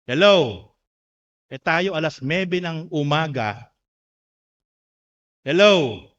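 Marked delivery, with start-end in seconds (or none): 0.0-0.7 loud prodding hello; sudden loud, falling "hello"; calling out. Real, not acted. Calls out to congregation after pause. 5.4-6.0 loud prodding hello; sudden loud, falling "hello"; calling out. Real, not acted. Calls out to congregation again.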